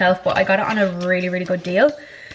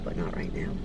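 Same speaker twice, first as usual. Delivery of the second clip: whispering; 11 dB quieter than usual. Real, not acted. Whispered after crying.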